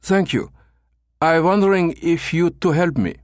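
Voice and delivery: a German fumbling for English; broken German English. A narrator's reading. Becker posing as German tourist, broken English. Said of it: "Becker continued in broken English".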